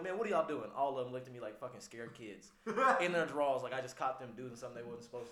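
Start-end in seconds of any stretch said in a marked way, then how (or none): 0.0-0.7 loud, high demand; exasperated shout. Retold or imitated. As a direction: yell down the hallway. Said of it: "and I'm like".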